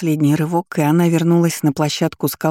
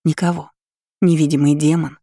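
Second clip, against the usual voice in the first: usual speaking voice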